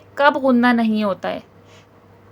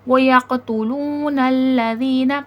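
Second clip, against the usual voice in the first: slow chanted reading with a stretched waw; measured tajweed chant; Quranic recitation. Shown as a demonstration. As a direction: recite it carefully, lengthening the long vowel. Teacher recites the verse.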